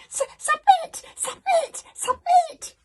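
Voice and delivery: very high loud shrieking stutter; dragon shriek. Acted. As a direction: shriek it at the top of your voice.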